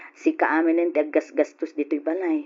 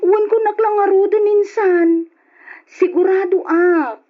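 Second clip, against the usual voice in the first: higher, louder insistence; emphatic, raised voice; insistent; 11 semitones higher, 5 dB louder than usual. Acted. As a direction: push hard to convince her. Insisting to cousin she is sure.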